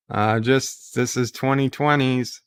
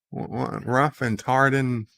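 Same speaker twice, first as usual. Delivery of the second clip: ordinary talk, nothing marked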